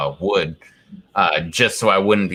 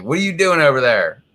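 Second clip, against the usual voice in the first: mock yell; 6 semitones higher, 4 dB louder, faster than usual. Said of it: "And I actually yelled at the dog".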